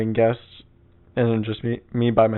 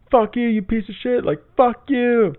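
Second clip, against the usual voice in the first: ranting crackhead; 12 semitones higher than usual. Retold or imitated. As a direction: imitate an unhinged man cursing someone out. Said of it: "he talked like a fucking crackhead".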